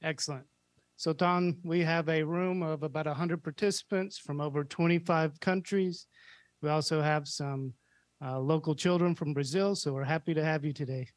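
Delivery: sci fi communicator. Real, not acted. Ground calls station over radio.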